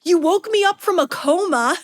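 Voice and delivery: high excited fangirl squeal; retold squeal. Starstruck fan to [name], high squeal. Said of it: "I just squeal out".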